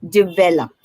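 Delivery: develop with stress on the second syllable, di-VEL-op; correct develop. Shown as a demonstration. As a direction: say develop as di-VEL-op, clean and even. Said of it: "For example".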